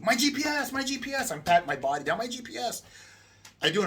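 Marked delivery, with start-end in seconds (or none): 0.0-1.3 much higher, louder frantic cry; retold panic at security, yelled; panicked yelling. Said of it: "I start yelling". 2.2-2.8 panicked yell. Retold or imitated. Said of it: "I start yelling".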